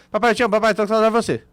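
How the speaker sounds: rattled off fast, words crammed together; sped-up audio. Retold or imitated. Daughter's message to dad played at two-point-zero, rushed and feelingless. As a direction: imitate a voice message on double speed. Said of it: "Aí você assiste acelerado".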